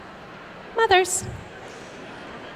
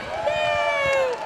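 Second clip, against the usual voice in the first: squeal of joy; 14 semitones higher, 5 dB quieter than usual. Real, not acted. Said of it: "cheer for favorite song".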